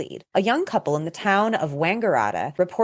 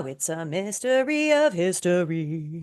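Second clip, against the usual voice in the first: mock eerie; slower than usual. Slow mock eerie close.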